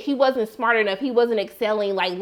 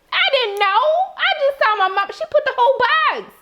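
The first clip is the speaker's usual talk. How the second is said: exasperated; 12 semitones higher than usual. Real, not acted. Grown daughter ranting about mom's spaghetti, high exasperated.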